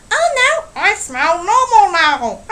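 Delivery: high, prissy put-on voice and a giggle; mocking snooty voice. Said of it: "and they're like".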